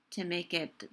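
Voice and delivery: usual speaking voice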